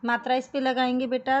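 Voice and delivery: ordinary delivery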